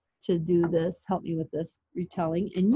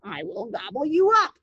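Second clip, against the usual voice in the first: troll threat swooping up high; rising, hammed-up troll threat; mean old troll; 6 semitones higher, 3 dB louder than usual. A narrator's reading. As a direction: menace as a storybook troll, leaping up at the end. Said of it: "said the mean old troll".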